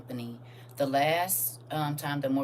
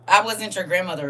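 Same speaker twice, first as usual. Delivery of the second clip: emphatic retort; 2 semitones higher, 7 dB louder than usual. Real, not acted. Grandmother setting a commenter straight, emphatic retort. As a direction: throw it back at them, louder.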